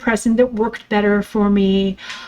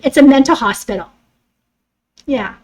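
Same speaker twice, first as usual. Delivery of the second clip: usual speaking voice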